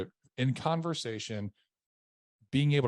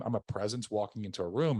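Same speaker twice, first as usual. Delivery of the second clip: ordinary talk, nothing marked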